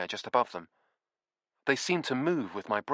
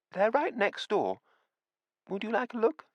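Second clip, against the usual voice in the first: a kindly widow coaxing guests next door; sweet old landlady; 13 semitones higher than usual. A narrator's reading. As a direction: draw them in like a sweet old hostess. Missus Beatty offers the books.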